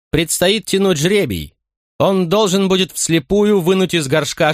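high, formal commander's proclamation; stern tribune announcement. A narrator's reading. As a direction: announce it like a Roman officer.

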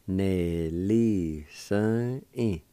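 very slow, stretched syllables; slow pronunciation demo. Shown as a demonstration. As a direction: linger on each syllable so learners can follow. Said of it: "it's pronounced like this".

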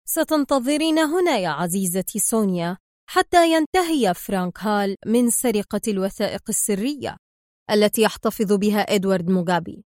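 plain reading voice

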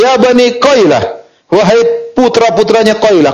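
high, carrying hail without real shouting; imitated loud call. Lookout calling to the sons of Qailah, imitated high call. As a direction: mimic someone calling a clan together. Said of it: "dengan suaranya yang paling kencang dia berkata".

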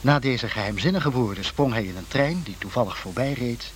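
normal talking voice

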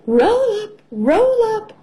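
high-pitched sing-out of a stallholder; fairground cry. A narrator's reading. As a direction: call out to draw a crowd, voice pitched high. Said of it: "cries Miss Rabbit".

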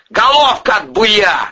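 high, loud, drawn-out roar; angry snarl. A narrator's reading. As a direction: roar the interruption down at them. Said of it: "зарычал Артём Павлович".

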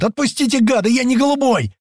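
very high, desperate yell; screaming. Acted. Паштет screams at the men holding him, desperate. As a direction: scream to be let go. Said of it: "вопит во всё горло Паштет".

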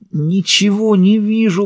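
high, slow, pained moan. A narrator's reading. Blinded prisoner complains he can't see, moaning. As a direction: wail it out slowly, as if hurting. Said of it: "простонал Пэл".

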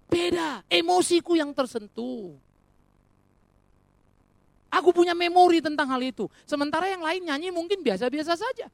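impassioned pulpit shout; forceful preaching. Real, not acted. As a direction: drive the point home at full volume. Preacher hammering the point home.

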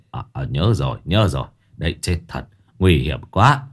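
put-on low old man voice, lightly done; Ông Tháo's voice. A narrator's reading. Old husband agrees nervously, low voice.

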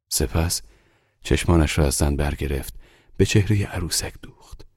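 usual speaking voice

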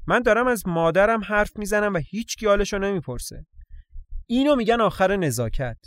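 indignant complaint. Acted. Johnny scolds the sisters for ignoring his mother, indignant. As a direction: complain like someone slighted.

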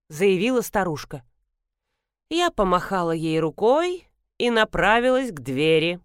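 ordinary delivery, nothing marked

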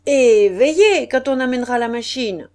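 loud call over the noise; shouted order. A narrator's reading. Stationmaster still calling out to his men, loud warning. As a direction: keep shouting the instruction to the crew. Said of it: "criait le chef de gare à ses hommes".

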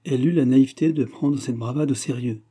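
usual speaking voice

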